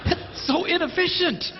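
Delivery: high-pitched mock protest; exasperated guy. Guy looks at twelve separate roses, high exasperated protest. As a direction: object in a pained, high voice.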